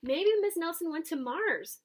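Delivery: put-on excited kid. A narrator's reading. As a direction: throw out the idea eagerly. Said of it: "said another kid".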